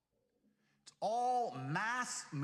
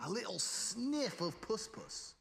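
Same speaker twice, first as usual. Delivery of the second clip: ordinary delivery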